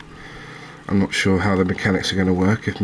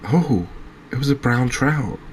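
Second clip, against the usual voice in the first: higher, surprised realisation; real surprise; 5 semitones higher than usual. Real, not acted. Angler finds the salmon is a brown trout, surprised. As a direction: react with genuine surprise, pitch lifted. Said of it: "I'm so surprised by that".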